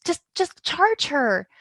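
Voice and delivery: high, loud exasperated plea; begging them to just act, fed up; frustrated pleading. Retold or imitated. To the police, fed up, a high exasperated plea. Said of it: "And I was so frustrated going".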